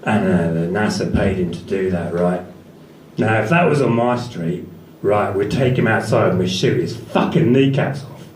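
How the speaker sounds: low Cockney growl, paranoid and insistent; Ray Winstone impression. Comic doing Ray Winstone ranting about the moon landings, low Cockney. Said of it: "something like this".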